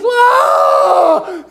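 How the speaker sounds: high, loud sobbing wail; woman overcome, wailing in tears; crying wail. Retold or imitated. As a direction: burst out in a crying shout. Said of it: "And she goes".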